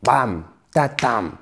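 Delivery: "Bam, tatam" as a slow, pounded rhythm; "Bam, tatam" with each beat struck; beating out stress. Shown as a demonstration. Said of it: "Faut vraiment taper sur la première syllabe".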